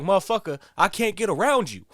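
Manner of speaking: a worker cursing his boss, mock angry and loud; mock angry outburst. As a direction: vent like a fed-up worker. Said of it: "It's like".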